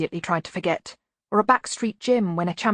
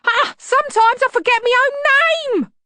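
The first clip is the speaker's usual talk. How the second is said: much higher, loud, rushing nervous babble; high-pitched wheeze; 10 dB louder than usual. A narrator's reading. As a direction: wheeze it out in panic, pitch climbing. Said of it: "his voice was a high-pitched wheeze".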